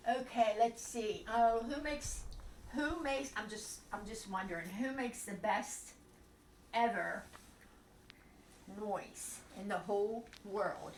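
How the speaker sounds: ordinary delivery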